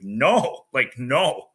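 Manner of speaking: loud frustrated no from the lifter; angry refusal. Retold or imitated. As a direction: refuse hard, furious at the miss. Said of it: "more of an anger and a, like, frustration".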